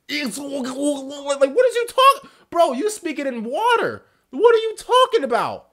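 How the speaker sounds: exasperated shouting. Real, not acted. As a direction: rant at the screen in real outrage. Baffled reaction to orc speech.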